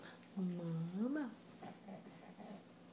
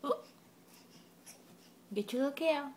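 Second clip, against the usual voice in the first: ordinary talk, nothing marked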